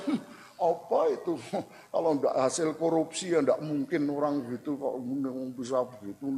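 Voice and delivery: scoff, then mutter low and soft; mock envious grumble. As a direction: imitate a sour, suspicious onlooker grumbling quietly. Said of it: "kita jangan bilang".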